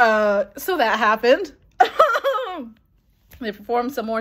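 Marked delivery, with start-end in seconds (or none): 1.8-2.7 high, delighted giggle; laugh, giddy and thrilled. Real, not acted. Giddy laugh after the memory.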